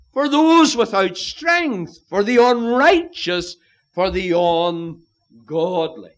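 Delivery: loud, high-pitched preaching at full force; وعظ حماسي. Real, not acted. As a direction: drive each phrase home to the congregation.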